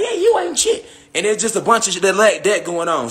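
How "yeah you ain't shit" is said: squeaky high taunt, stress on you; mocking high voice. Vlogger mimicking feuding insiders, in a squeaky high taunt. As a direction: pipe it up high and snotty, hitting "you". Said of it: "others like".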